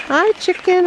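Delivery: high sing-song greeting. Real, not acted. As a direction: coo at the chicken. Greeting the chicken.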